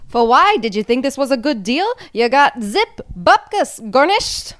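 put-on loud, high Yiddish-accented complaint. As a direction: kvetch loudly in a Yiddish accent. Continues Yiddish scolding bit.

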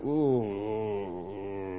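low, sinking groan of distaste; disgusted groan. Retold or imitated. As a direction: sigh out a sour "Oh". Reaction at rival's name.

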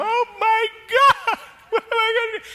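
hammed-up panic over a husband with no job; mock panic. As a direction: shriek it like you're losing it at home. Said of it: "I'd be home going".

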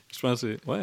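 talking around a sip of coffee; while sipping coffee. Real, not acted. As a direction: mouth near the cup, sipping. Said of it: "prendre une petite gorgée de café".

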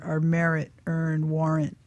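ordinary talk, nothing marked

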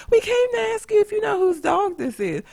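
high, sugary imitation of the mom; mocking the mom. Neighbor mom explaining the found dog, high mocking voice. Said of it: "the mom talking about".

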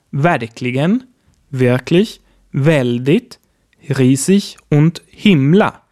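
ordinary delivery

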